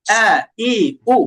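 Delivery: each vowel struck from the throat; firm hamza. Shown as a demonstration. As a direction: model "আ, ই, উ" firmly, breath stopped. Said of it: "সিফাত হচ্ছে শক্ত করতে হবে".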